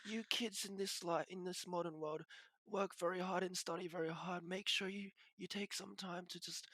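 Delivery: high, soft doting grandma; Korean grandma voice. Retold or imitated. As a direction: coo like a sweet old grandmother. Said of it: "wholesome Korean grandma videos where they're like".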